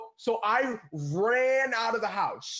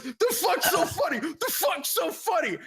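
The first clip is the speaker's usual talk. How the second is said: higher, mock shout; retold angry yell; 9 semitones higher than usual. Friend yelling at the laughing pranksters, mock angry shout. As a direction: imitate the baffled friend yelling.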